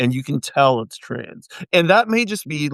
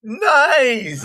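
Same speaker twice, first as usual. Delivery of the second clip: high excited shout; excited cheer; 10 semitones higher than usual. Real, not acted. Cheering a friend's singing.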